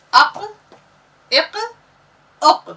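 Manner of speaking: अक, इक, उक called out high and loud; high loud recital. Shown as a demonstration. Teacher calling out अक, इक, उक to students, high and loud. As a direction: ring out अक, इक, उक for the class. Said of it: "teacher recites syllables for class".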